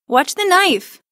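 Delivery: high loud frightened shout; frightened boy shouting at his knife-waving sister; alarmed cry. Acted. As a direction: yelp in sudden fear. Boy cries out in fear.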